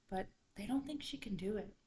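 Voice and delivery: ordinary delivery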